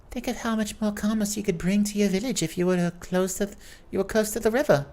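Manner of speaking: put-on high-pitched cheery elderly lady voice; high jovial old lady. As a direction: play the chatty old aunt, bright and high.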